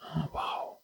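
breathless whispered wow; hushed in wonder at the verse; awed whisper. Real, not acted.

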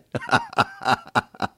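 long, high-pitched real laughter; hearty laugh. Real, not acted. Preacher laughing at his own nice-guy joke, hearty.

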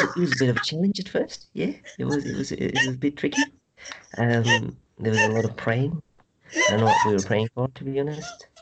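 lightly hammed-up Kiwi accent; put-on Kiwi accent. Guest chatting to the host, in a mock New Zealand accent. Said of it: "You're talking to Taika Waititi".

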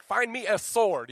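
slow, booming battle cry; hammed-up action hero; mock warrior. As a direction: growl it like a movie gladiator. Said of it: "you're like".

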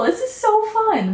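high, slow delighted exclaim; excited high-pitched "so fun". Real, not acted. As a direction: gush with joy. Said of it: "Wait, I love this".

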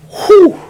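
sharp, high exhaled whew; loud high whew. Real, not acted. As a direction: let out a big, emotional whew. Exhales after emotional retold speech.

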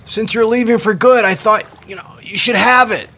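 loud high shout up to the ferry; calling up. Acted. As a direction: holler it up, half sheepish. Shouting up to departing Erin.

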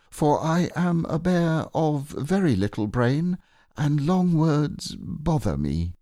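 a humble little bear of little brain; Pooh voice. Acted.

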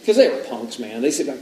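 normal talking voice

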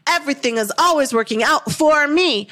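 rising belief, the third round of the chant; building affirmation. Shown as a demonstration. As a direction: push "always" up and out with conviction. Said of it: "So repeat after me".

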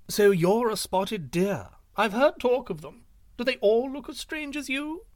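a nosy bird sizing up the stranger; high inquisitive pheasant voice; curious pheasant. A narrator's reading.

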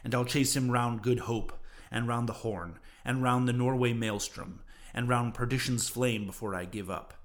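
ordinary delivery

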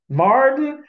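mock outrage aimed at the driver; mother scolding. Mother in the church car scolds dad, high sharp rebuke.

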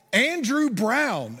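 drawn-out name call, x0.5 pace; slow announcement. Retold or imitated. Teacher slowly calling the winner, drawn-out announcement. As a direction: stretch out Andrew Brown slowly. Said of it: "And he said".

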